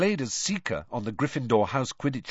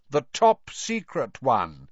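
slow, pointed and a little raised; slightly raised voice; 4 semitones higher, 4 dB louder, slower than usual. A narrator's reading. Percy hinting at his secret work, voice slightly raised. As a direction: spell it out slowly for listeners to overhear. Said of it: "He raised his voice slightly".

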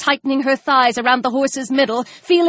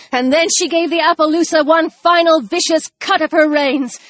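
ordinary delivery

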